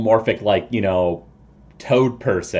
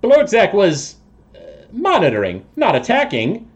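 slightly high toad voice, protesting innocence; evasive toad creature; 6 semitones higher, 4 dB louder than usual. Acted. Toad person denies attacking people, evasive and slightly high. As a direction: deny it as a shifty toad-man.

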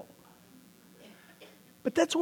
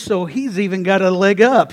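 ordinary delivery

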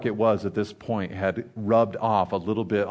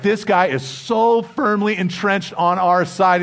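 proud boast; 9 semitones higher, 5 dB louder, slower than usual. Retold or imitated. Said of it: "he's like".

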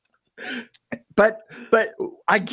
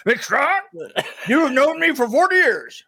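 a touch higher, mock-indignant friend; lightly voiced R A, scolding an old friend; imitating R A; 6 semitones higher than usual. Storyteller mimics R A complaining about his name, lightly voiced. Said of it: "'cause R A talks like this".

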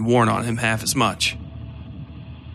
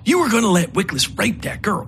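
lightly voiced angry hiss; 9 semitones higher than usual. A narrator's reading. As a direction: accuse him in a sharp hiss. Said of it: "Miskell hissed".

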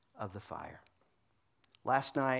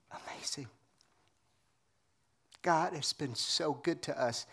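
ordinary delivery

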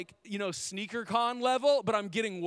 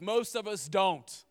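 normal talking voice

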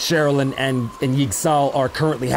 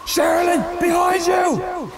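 shouted warning; slower than usual. Acted. Edbert warning Sherilyn of the undead, shouted high. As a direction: yell it to warn her of danger. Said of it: "He shouts out a warning".

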